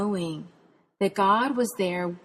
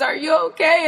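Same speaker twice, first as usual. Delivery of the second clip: 1 tearful asking; 8 semitones higher, 5 dB louder than usual. Retold or imitated. Dad, tearful.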